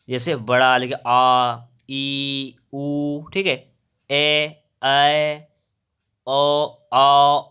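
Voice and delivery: आ, ई, ऊ held for two beats each; long vowels demonstrated. Shown as a demonstration. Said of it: "दो मात्रा का समय लगता है". (दीर्घ स्वरों को खींचकर बोलना)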